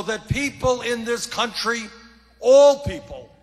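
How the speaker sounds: loud, fired-up stump speech; rally speech. Acted. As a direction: rouse the crowd from the podium.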